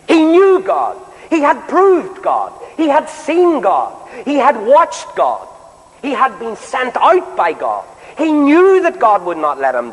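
preaching climax. Acted.

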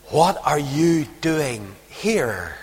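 very slow, soft, spaced-out question; searching question. Acted. God's searching question to the hiding prophet, slow and soft. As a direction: weigh each word slowly and quietly. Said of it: "the searching question".

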